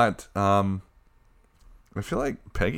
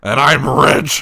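hammy announcer voice; 6 semitones higher, 10 dB louder, slower than usual. Pretend. Put-on persona introduction.

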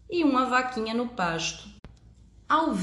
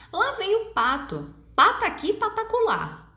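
playful high voice; 10 semitones higher than usual. A narrator's reading. Playful poem opening, high.

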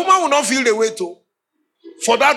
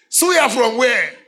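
incredulous; 4 semitones higher, much slower than usual. Retold or imitated. Incredulous reply at midnight.

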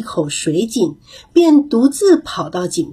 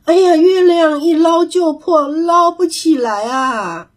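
frantic cry; 6 semitones higher than usual. A narrator's reading. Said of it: "他急坏了，叫道".

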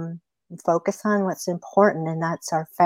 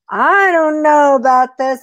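high, loud, wary protest; doubtful coyote; 7 semitones higher, 6 dB louder than usual. Acted. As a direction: balk loudly at the suggestion.

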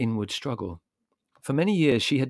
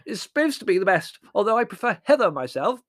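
high, loud dotty aunt; mouth full of toast; 12 semitones higher, 8 dB louder than usual. A narrator's reading. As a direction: pipe up loudly through a mouthful. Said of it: "through a mouthful of toast".

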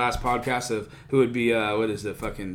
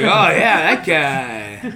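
laughing impression; 5 semitones higher, 9 dB louder, much slower than usual. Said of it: "He'd be like".

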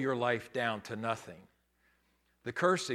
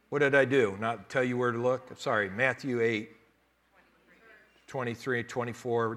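usual speaking voice

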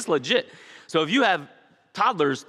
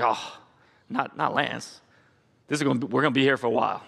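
classmates sighing; 3 semitones lower than usual. Retold or imitated. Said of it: "hear the sigh in the room".

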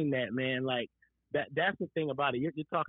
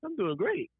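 upbeat reply; 11 semitones higher than usual. Retold or imitated. He answers the doctor brightly.

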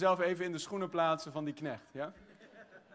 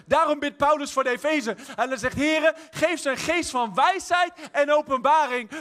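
rising, louder preaching; rousing preacher's crescendo; building preaching; 7 semitones higher, 7 dB louder than usual. Acted. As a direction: climb toward a shout.

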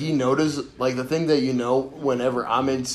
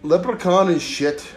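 high, hammed-up mock Irish; slow down into a terrible Irish accent; bad Irish accent; 6 semitones higher, 3 dB louder than usual. Mocking another's leprechaun act, in bad Irish accent. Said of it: "Irish accent, but it sounds like a terrible Russian accent".

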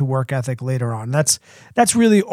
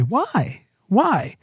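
put-on a light, higher toddler voice asking over and over; nagging toddler; 5 semitones higher, 4 dB quieter than usual. Lightly voiced toddler pestering. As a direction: mom imitating her three-year-old's endless whys. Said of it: "that's all they say".

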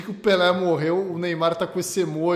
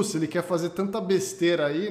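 ordinary talk, nothing marked

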